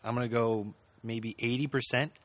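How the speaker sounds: usual speaking voice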